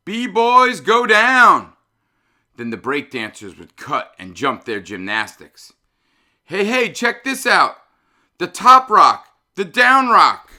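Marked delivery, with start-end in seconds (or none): 0.1-1.8 loud, high hype shout. Retold or imitated. DJ shouting at the dancers during a good break. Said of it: "he'd shout". 6.4-10.5 loud, high hype-man calls; excited hype. Retold or imitated. MC hyping breakdancers on the floor.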